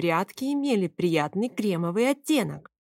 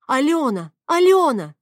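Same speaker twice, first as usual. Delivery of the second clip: loud, high call of a name; alarmed shouted name; urgent shout; 7 semitones higher, 8 dB louder than usual. A narrator's reading. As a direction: call out to stop her. Shouting to stop the fight.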